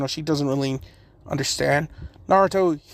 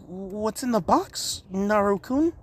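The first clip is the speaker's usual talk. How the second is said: put-on curious girl; 6 semitones higher, 3 dB quieter, slower than usual. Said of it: "Samui's just like".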